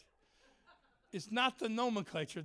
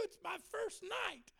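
put-on pleading boy; 14 semitones higher, 5 dB quieter, slower than usual. Crying boy's plea.

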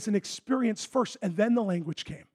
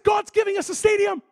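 higher and louder, bursting with it; excited retold claim; 12 semitones higher, 7 dB louder than usual. Preacher retelling his bold announcement to his wife, excited. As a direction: announce it like good news.

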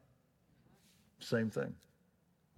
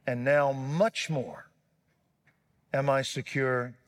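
fervent prayer; 2 semitones higher, 5 dB louder than usual. Real, not acted. Preacher leads the church in communion prayer, fervent. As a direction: pray it with full conviction.

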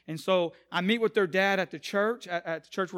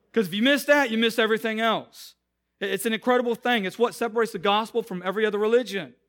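higher, louder preaching; 4 semitones higher, 8 dB louder than usual. Acted. The pastor stressing the gospel to the church, louder, higher preaching. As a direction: preach it with fire.

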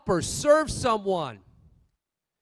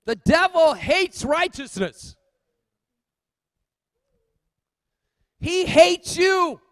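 higher, louder, slow emphatic climax; preaching at full force; 10 semitones higher, 9 dB louder, slower than usual. Real, not acted. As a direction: lean into every word, slowly.

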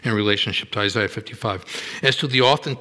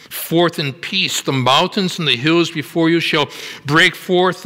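raised, ringing scripture reading; lifted, higher preaching voice; 5 semitones higher, 4 dB louder than usual. Acted. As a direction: proclaim the verse to the whole room. Reads Isaiah at full force.

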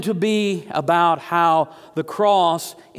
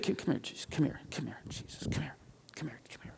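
whispered aside, beckoning someone over; acted-out hush, repeating it under the breath; urgent whisper; 5 semitones lower, 17 dB quieter, much faster than usual. Peter pulling Jesus aside, whispering.

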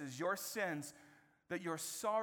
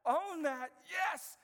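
much higher and louder, pushing forward; excited high urging voice; eager Peter; 11 semitones higher, 6 dB louder than usual. Retold or imitated. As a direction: Peter cheering them on to go tell everyone. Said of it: "He's like".

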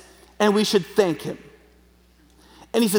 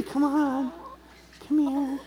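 coaxing; 6 semitones higher, 7 dB quieter, much slower than usual. Real, not acted. Coaxing the chicken.

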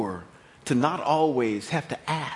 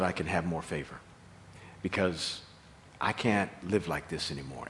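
humble plea; 9 semitones lower, 6 dB quieter than usual. Acted. Moses pleads with God.

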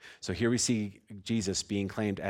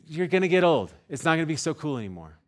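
forceful warning; 6 semitones higher, 8 dB louder than usual. Real, not acted. Pastor warning congregation that cool fades, loud and forceful. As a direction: drive the warning home hard. Preacher warns the cool crowd.

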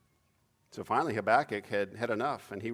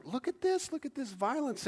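much higher, urgent pointing cry; yelling; 14 semitones higher than usual. Acted. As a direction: make them look, pleading upward. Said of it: "I'm yelling".

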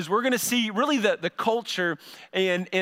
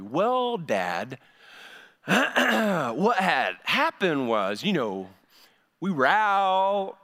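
slow, halting fake excuse; stalling teen; much slower than usual. Shown as a demonstration. Teen stalling with a slow excuse to dad about curfew. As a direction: drag it out like a kid buying time. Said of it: "slow down with their explanations".

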